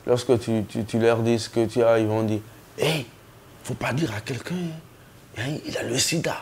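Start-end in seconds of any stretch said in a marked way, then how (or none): 2.8-6.4 hushed gossip. Retold or imitated. Gossip passing on a secret.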